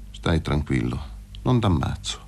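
low, contained reassurance; cool dismissal. A narrator's reading. Fazio sparing the buffoon Paroni, cool dismissal. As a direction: let him off, dropped low. Fazio coldly calls off the shooting.

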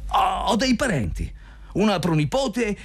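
difesa risentita in tono molto acuto; punto sul vivo. A narrator's reading. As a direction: protesta esitante, con voce alta di tono. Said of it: "punto sul vivo". (replica offesa e balbettata in alto)

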